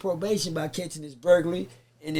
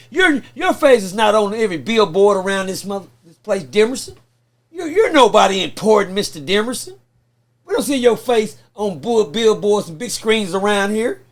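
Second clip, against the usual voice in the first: loud, hammering courtroom cross-examination; going hard; 2 semitones higher, 8 dB louder than usual. Retold or imitated. Prosecutor belittling the witness on the stand, loud and hard. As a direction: imitate a prosecutor tearing into the witness. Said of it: "He went hard on me".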